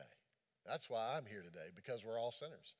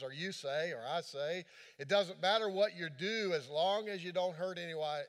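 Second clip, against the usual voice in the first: full-force preaching; 5 semitones higher, 6 dB louder than usual. Acted. Preacher driving home the message to the congregation, booming. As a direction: preach it out to the whole room.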